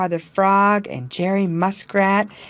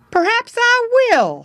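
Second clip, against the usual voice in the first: much higher, louder character voice; musing aloud as the beaver; thoughtful beaver; 5 dB louder than usual. A narrator's reading. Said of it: "said he".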